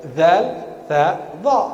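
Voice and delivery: ZAL, THA, DHA from the tip of the tongue; crisp teacher's demo of "Zal, tha, dha"; tongue-tip consonants. Shown as a demonstration. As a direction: demonstrate each letter with just the tongue tip. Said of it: "ujung lidah".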